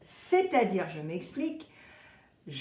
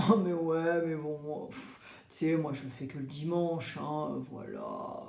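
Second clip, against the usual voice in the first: dismissive shrug-off; 3 semitones lower than usual. Retold or imitated. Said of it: "tu vas dire".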